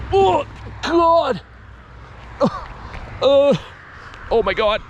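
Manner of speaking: straining under a heavy weight; strained high grunting while lifting; carrying heavy load. Real, not acted. Lifting a huge heavy battery.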